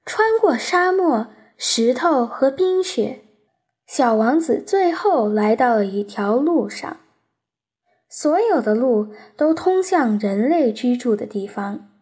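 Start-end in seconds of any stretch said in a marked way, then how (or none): none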